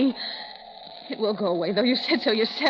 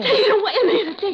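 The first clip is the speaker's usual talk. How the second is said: frightened refusal; 6 semitones higher, 7 dB louder than usual. Acted. Refuses food, frightened and angry.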